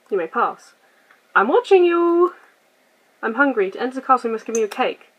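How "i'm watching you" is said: higher, louder mock-menacing taunt. Game character warning the player, hammed-up threat. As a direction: play it like a spooky villain.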